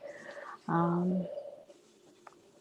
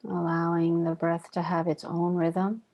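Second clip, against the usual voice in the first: calm, even meditation voice with long pauses; guided meditation. Real, not acted. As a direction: guide them gently inward, leaving long silences between phrases. Leads guided breathing meditation.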